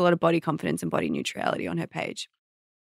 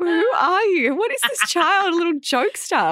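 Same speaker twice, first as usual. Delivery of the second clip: high-pitched, incredulous questioning; mock bewilderment; 10 semitones higher than usual. Mother replaying her reaction to her joking toddler, mock bewilderment. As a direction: question it in comic amazement, pitched up. Mother's retold amazement at jokester child.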